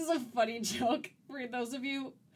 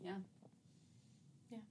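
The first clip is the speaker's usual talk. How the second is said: normal talking voice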